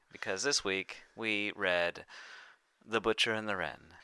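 normal talking voice

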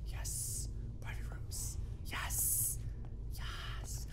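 hushed whisper to himself; soft sneaky whisper while playing. Real, not acted. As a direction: whisper as if sneaking past someone. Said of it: "I don't know why I'm whispering".

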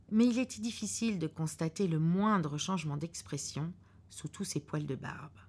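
plain reading voice

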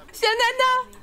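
high put-on character voice; character's eager request. Asking Nana to be wedding witness, high pretend voice. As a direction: ask in a hammed-up high pitch.

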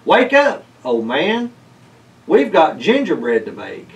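put-on shouting to wake a sleeping old man; shouted wake-up call. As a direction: yell it into his ear. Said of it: "Mercury shouted".

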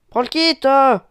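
loud high urging; urgent shout. Real, not acted. Shouting at a teammate to grab the kit. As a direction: yell the order.

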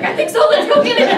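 very high, loud excited pup voice, laughing; giggling puppy voice; dog's voice. Retold or imitated. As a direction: squeal it like an eager puppy ready to run. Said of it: "And he's like".

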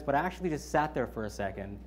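ordinary talk, nothing marked

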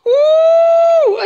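a long, very high, very loud cry of joy; joyful scream. Real, not acted. Gamer wins the race at the last second, screaming with joy. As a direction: let out a real victory shriek, drawn out slowly.

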